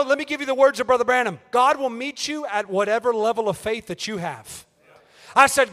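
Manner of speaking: usual speaking voice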